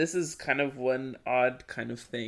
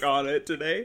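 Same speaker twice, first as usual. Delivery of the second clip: ordinary talk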